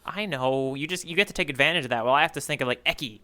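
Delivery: ordinary talk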